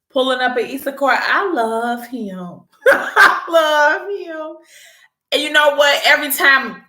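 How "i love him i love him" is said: slow, drawn-out, laughing adoration; giggly swoon. Real, not acted. Narrator swooning over Messiah to viewers, slow and giggly. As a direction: melt into a giggle over him. Gushing about Messiah again.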